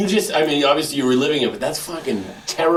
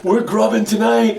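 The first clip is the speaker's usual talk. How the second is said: hyped up; 8 semitones higher, slower than usual. Retold or imitated. Hyped plan quoted.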